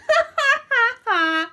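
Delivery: high, loud acted cackle; howling mock laughter. A narrator's reading. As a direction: laugh like a pack of mocking werewolves. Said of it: "howled with laughter".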